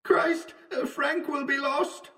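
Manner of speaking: thin, very high far-off call; distant cry. A narrator's reading. Wells somewhere in the fog, a faint distant cry. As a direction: call from far away, soft and pitched high. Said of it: "It was the voice of Wells".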